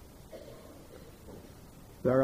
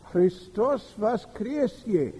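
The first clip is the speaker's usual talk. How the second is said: put-on old man's quavering Christos voskresie; slow, high, trembling old man voice; 6 semitones higher, slower than usual. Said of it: "said in a quavering voice".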